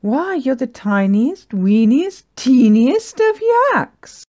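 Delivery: high, loud, building to a drawn-out squeal; squealed amazement. Acted. Gertie marvelling at the teeniest yak, squealing. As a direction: gush in growing astonishment. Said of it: "Gertie squealed".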